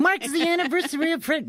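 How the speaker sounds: high squeaky helium voice. Pretend. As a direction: pitch way up like a balloon. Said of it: "it kinda got British".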